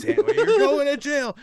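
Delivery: high, loud mock arrest shout; pretend cop yelling. Fake cop to kid in custody, yelling. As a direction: holler it through laughter like a struggling cop. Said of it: "make all the sound like he's".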